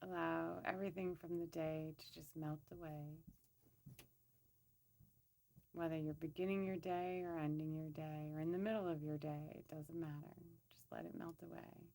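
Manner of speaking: soft, even-pitched meditation voice; guided meditation. Acted. Woman leading listeners to center and scan their bodies, soft meditation voice. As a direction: gently guide them inward, leaving long pauses.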